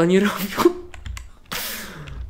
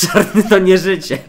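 laughing; 6 semitones higher, 7 dB louder than usual. Acted. Joking after the killing.

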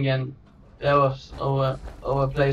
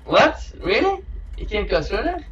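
much higher, disbelieving questions; real surprise; 7 semitones higher than usual. Real, not acted. Player reacting to a blocked passage, surprised and much higher. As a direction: genuinely caught off guard. Game blocks him unexpectedly.

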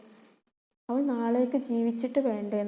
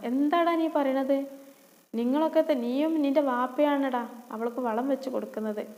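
loud, higher stepmother call from another room; angry shout; 3 semitones higher, 3 dB louder than usual. Acted. Stepmother shouts at her son from inside, angry. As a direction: bark back at the boy.

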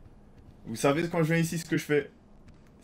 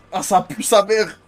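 loud disgusted groan; 3 semitones higher, 7 dB louder, slower than usual. Real, not acted. Head stuck in dog kennel.

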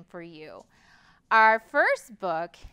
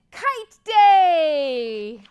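loud, higher, drawn-out call; joyful shout; 5 semitones higher, 12 dB louder than usual. A narrator's reading. Narrator voicing Bear announcing Kite Day to all, joyful shout. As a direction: shout it out with a long held last word. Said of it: "He smiled and shouted".

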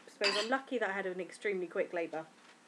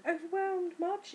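sarcastic; 9 semitones higher, slower than usual. Real, not acted. Ironic rhetorical remark.

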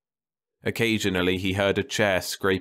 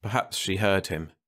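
plain reading voice

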